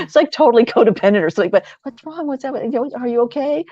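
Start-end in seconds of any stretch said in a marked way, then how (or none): 1.8-3.6 gentle concern. Retold or imitated. Comforting a sobbing teen.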